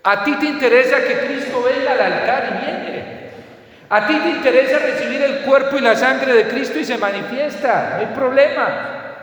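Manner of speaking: preacher's climax, calling out; fervent preaching. Acted. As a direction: hammer each al Señor home. Preacher's emphatic appeal.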